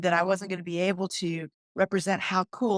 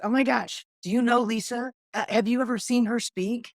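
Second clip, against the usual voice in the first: higher, louder eager praise; mock gushing; 6 semitones higher, 3 dB louder than usual. People in rooms raving about Lisa, higher and louder in mock excitement. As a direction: gush like a fan in the room. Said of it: "it's always this".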